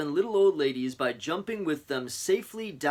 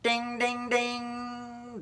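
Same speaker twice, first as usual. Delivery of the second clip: alarm bell; 11 semitones higher, much slower than usual. Acted.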